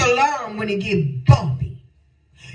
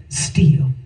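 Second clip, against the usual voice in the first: whispered still; 4 semitones lower than usual. Shown as a demonstration. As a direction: whisper the word Still, barely voiced. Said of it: "So in spite of it all".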